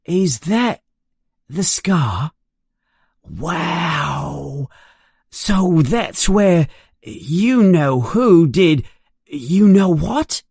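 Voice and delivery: put-on high, slow, awed boy voice; boy gawking at a famous scar; awed whisper-like wonder. A narrator's reading. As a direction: lean in, fascinated by the scar.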